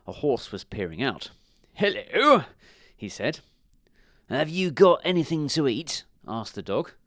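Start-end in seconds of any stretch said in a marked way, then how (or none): 1.7-2.5 a bright, high, loud dog's hello; dog's greeting. Acted. Dog greets the horse. 4.3-6.1 a lightly voiced, hopeful dog asking; hungry dog. Hungry dog asks for food.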